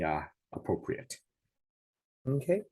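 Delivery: ordinary talk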